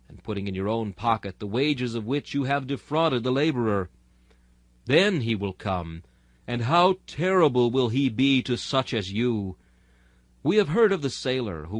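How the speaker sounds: usual speaking voice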